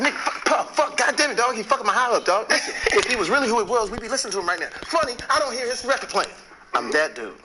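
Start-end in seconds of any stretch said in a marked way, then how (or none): 0.0-6.3 high-pitched, loud protest; retold angry rant. Partygoer complaining to Puff about the newcomer, high angry rant. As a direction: rant at full tilt, pitched way up.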